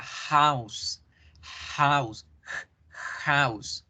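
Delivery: "house" with a breathy h; English house. Shown as a demonstration. Teacher modelling "house" for Spanish-speaking students. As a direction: say "house" slowly, the h as a soft puff of air. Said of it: "Lo mismo la palabra casa".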